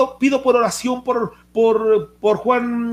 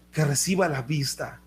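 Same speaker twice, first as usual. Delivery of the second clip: repetición suave; 6 semitones lower, 6 dB quieter than usual. Shown as a demonstration. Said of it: "repite la petición en voz baja".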